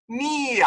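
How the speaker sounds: nia with the R dropped; wrong nia. Shown as a demonstration. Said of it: "This is not nia".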